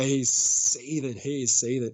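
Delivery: slow, stretched-out SEETHING; lingering hard on "seething"; drawn-out emphasis. Real, not acted. Teller dwells on the word seething.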